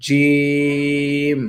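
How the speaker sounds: জিম drawn out very slowly and firmly; teacher's slow জিম; model jim. Shown as a demonstration. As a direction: stretch জিম long and heavy. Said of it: "সংযুক্তভাবে".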